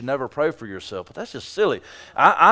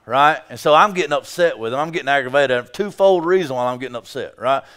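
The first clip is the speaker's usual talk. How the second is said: ordinary talk, nothing marked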